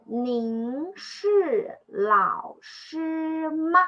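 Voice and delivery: slow model reading. Shown as a demonstration. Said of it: "So this sentence is".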